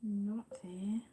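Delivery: whispered under her breath. Real, not acted. Searching her pages for Terry's photo, whispering. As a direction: whisper to yourself while searching.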